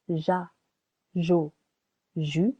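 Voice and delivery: "ja, jo, ju" each with a soft zh sound. Shown as a demonstration. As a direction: say "ja, jo, ju" with a soft French j. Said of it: "To make it sound".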